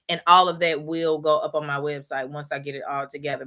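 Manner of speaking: ordinary delivery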